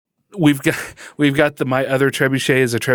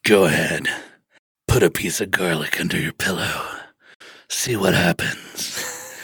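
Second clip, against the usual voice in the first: Dirty Harry style; 7 semitones lower, 4 dB quieter than usual. Acted. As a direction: growl it like a tough cop. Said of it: "Dirty Harry style".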